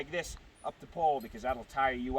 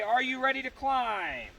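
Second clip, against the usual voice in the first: demo call; 9 semitones higher than usual. Shown as a demonstration. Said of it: "I'll say".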